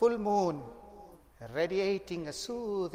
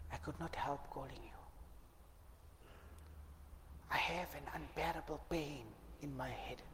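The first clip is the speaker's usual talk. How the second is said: pained murmur; 2 semitones lower, 15 dB quieter than usual. Acted. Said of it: "her voice is reflecting pain".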